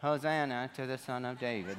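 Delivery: soft, low, listless murmur; timid non-shout. Shown as a demonstration. Pastor showing kids how nobody cheered on Palm Sunday, meekly. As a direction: say it small and low, no shouting at all. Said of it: "Nobody was saying, ... They weren't doing that".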